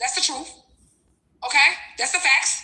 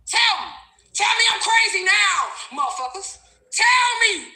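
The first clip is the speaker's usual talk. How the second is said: demanding shout; 6 semitones higher than usual. Real, not acted. Daring viewers to call her crazy.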